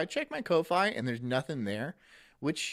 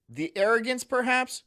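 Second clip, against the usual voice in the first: normal talking voice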